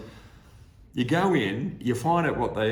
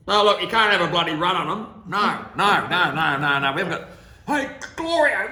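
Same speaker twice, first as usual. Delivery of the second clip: high, loud, laughing canteen lady; hammed-up canteen lady crying no no no; 8 semitones higher, 8 dB louder than usual. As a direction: belt it out like a bossy dinner lady, half laughing. Canteen lady voiced, refusing quiches.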